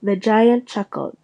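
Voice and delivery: ordinary delivery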